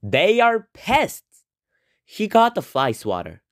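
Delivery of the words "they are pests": dad sneering at flies; lightly voiced disgusted father; dad's scorn. A narrator's reading. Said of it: "said Dad".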